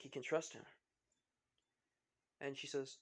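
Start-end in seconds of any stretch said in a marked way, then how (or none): none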